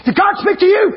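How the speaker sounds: high, excited burst; urgent question. Retold or imitated. Preacher re-enacting running in to ask his wife, high urgent question. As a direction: blurt it out urgently, pitch jumping up.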